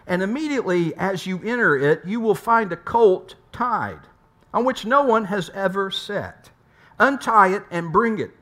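ordinary delivery

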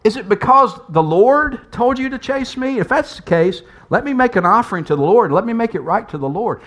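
acted, earnest challenge; acted questioning. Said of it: "he even asks the question".

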